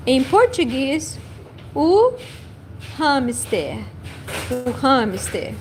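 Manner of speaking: ordinary delivery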